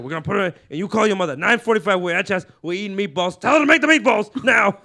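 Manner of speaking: building from talk into a loud, high, laughing rant; Joey Diaz riffing. Retold or imitated. Retelling Joey Diaz roasting an open mic crowd, loud laughing rant. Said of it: "That's a good impression".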